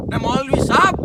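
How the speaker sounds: loud call with rising end; loud challenge. Acted. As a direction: throw the address out as a challenge. Shepherd challenges the cleric. (ऊँची आवाज़ में ललकारना)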